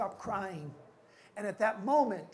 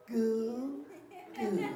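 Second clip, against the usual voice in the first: babies' goo. Acted. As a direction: coo like a contented baby. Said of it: "babies all over the world say".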